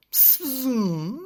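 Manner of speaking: sharp high psst sound. Acted. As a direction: hiss a secret psst. Bridget whispers to Captain Cat.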